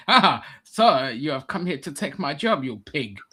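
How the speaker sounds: mock-indignant German accent. German accuses immigrant of taking his job, mock German accent. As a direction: accuse him as a cartoon German. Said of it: "speaking in a German accent".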